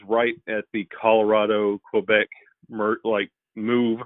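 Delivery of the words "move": ordinary talk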